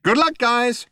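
very high, loud, cheery computer voice; perky shipboard computer; chirpy computer. A narrator's reading. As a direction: chirp it brightly at the crew. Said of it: "chirped the computer".

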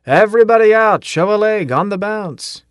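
high, loud barrack-room yell; bellowing sergeant rousing recruits; yelling wake-up call. A narrator's reading. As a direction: roust the sleepers with a shout.

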